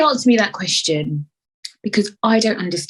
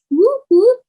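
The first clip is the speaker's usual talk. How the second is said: high, loud celebratory whoop; cheering whoop; 6 dB louder than usual. Real, not acted. Cheering about trip.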